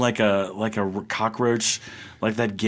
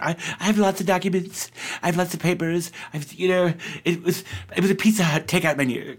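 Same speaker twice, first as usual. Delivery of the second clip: higher, rushed imitation of Trump dodging; mock Trump; 6 semitones higher, faster than usual. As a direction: lightly mimic Trump's flustered excuse, fast. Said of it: "He's like".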